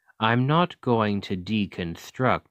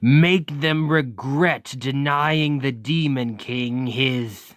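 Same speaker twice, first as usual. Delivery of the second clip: building higher and louder into a tirade; villainous rant; 4 semitones higher, 3 dB louder than usual. Acted. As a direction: work yourself up into a vengeful outburst.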